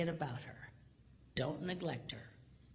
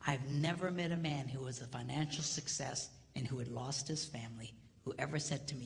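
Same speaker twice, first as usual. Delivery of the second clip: usual speaking voice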